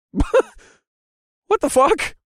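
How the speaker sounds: high, loud laughed-out exclamation; laughing disbelief. A narrator's reading. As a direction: burst out laughing at the absurd sight. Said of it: "I laughed at the absurdity".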